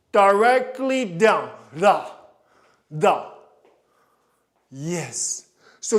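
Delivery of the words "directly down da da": slow, loud "Directly down"; loud called-out correction; loud command. Shown as a demonstration. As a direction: bark the correction across the studio. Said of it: "coach calls out correction".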